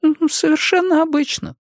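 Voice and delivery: высокий голос пожилой женщины; мягкая разговорчивая старушка. A narrator's reading. Jenny answers. (голос старушки)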